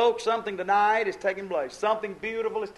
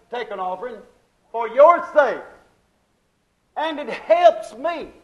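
high, loud, punchy pulpit delivery; emphatic preaching; 6 semitones higher, 10 dB louder than usual. Real, not acted. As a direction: drive each point home to the congregation.

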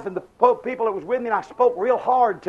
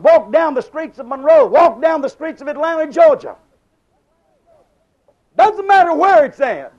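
higher and louder, urging; preaching; 4 semitones higher, 6 dB louder than usual. Acted. Preacher urging believers to walk the streets, loud. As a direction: push it out like a rallying call.

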